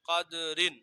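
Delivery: ordinary delivery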